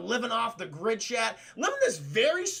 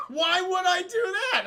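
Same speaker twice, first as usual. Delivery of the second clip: fake innocent; 9 semitones higher, 4 dB louder than usual. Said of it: "He goes".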